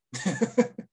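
short high sheepish laugh; embarrassed laugh. Real, not acted. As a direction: laugh off the slip. Laughs after losing the word.

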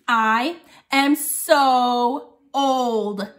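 very slow, each word spaced; drawn-out I am so old; slow example sentence. Shown as a demonstration. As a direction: read it out like a lesson. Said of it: "I thought of one".

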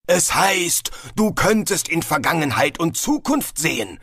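sulky, offended reply; huffy. A narrator's reading. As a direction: answer in a huff, a little hurt. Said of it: "erwiderte Galder eingeschnappt".

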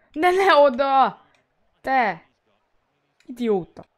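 loud, slow frustrated yell; frustrated shout. Real, not acted. Yelling at the game.

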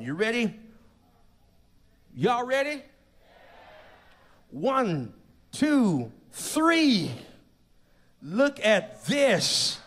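loud, high announcer-like build to the unveiling; rousing countdown reveal. Real, not acted. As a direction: count it down big and stretch the reveal.

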